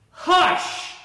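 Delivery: sharp, high, hissed loud hush; loud scolding hush. Shown as a demonstration. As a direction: silence a barking dog. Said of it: "Maybe not that loud".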